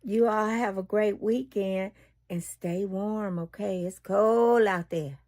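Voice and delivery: ordinary delivery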